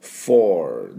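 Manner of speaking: four very slow, in English; teacher stretching four for students; drawn-out four. Shown as a demonstration. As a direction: stretch four out like a model word. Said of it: "四のfourですね".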